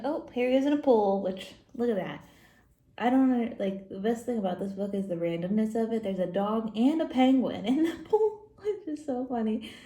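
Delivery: ordinary delivery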